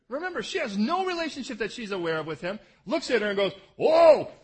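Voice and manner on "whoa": loud, high startled whoa; sudden shout of wonder; acted whoa. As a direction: burst out in amazement. Pastor acts out Jacob at well.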